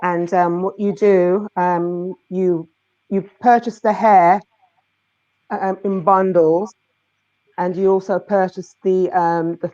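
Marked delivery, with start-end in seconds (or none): none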